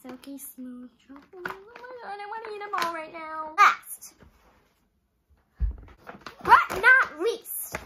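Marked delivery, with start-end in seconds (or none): 3.6-7.8 dramatic announcement. Acted. Grand reveal of last item.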